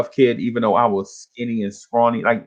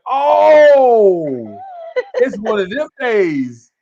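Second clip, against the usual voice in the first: acted realization; 8 semitones higher, 5 dB louder, much slower than usual. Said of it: "And I'm like".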